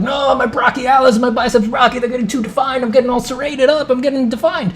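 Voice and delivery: high whiny protest; whiny complaint. Retold or imitated. Owner complaining his muscles are too defined, whiny. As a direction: whine it like a fussy bodybuilder. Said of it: "He's like".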